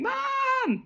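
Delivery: very high, drawn-out mock scream; hammed-up scream for a parent. Frightened girl in a story screaming for her mother, hammed up. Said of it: "starts screaming".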